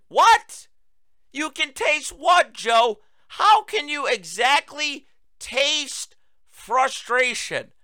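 high, loud, slow disbelief; incredulous outburst. Real, not acted. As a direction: demand an answer in outraged disbelief. Disbelief at the quote.

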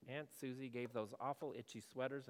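normal talking voice

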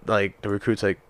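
normal talking voice

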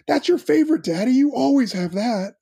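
put-on high, sing-song little-kid voice; toddler voice. Dad imitating his toddler son pointing at beer, high and innocent. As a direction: chirp it like a proud two-year-old. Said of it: "And he's like".

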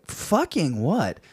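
usual speaking voice

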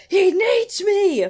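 high, slow, drawn-out mock wail; desperate wail. Cohost imitating the drunk caller pleading with the operator. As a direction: stretch the plea out like a drunk begging.

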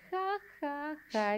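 ja ja agudo y suave, sin ganas; risa fingida. Retold or imitated. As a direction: lee la risa del chat, burlona. Lee una risa escrita en chat. (risita aguda y suave)

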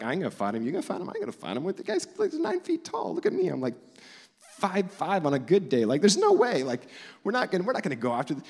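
high, whiny, mock-frightened protest; scared soldiers. Israelite soldiers refusing to fight Goliath, mock scared.

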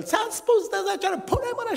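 high mock yell of a mother; imitating a mother screaming at her child. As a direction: shriek it like an angry mom at her kid. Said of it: "mama s-a țipat la el".

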